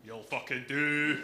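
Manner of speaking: slow, loud impression of mum; slow, emphatic mum voice. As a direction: do your mum, drawn out and loud. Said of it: "That's an impression of my mum".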